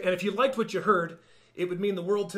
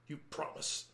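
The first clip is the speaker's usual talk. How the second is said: hushed, breath-only scoff; near-whispered, contemptuous question; 11 dB quieter than usual. A narrator's reading. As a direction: scoff it softly, disbelieving. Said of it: "scoffed Franklin".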